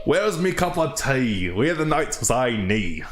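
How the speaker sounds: put-on a deliberately terrible Cockney accent; bad Cockney accent. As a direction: play an American faking Cockney. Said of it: "terrible American interpretation of a Cockney accent".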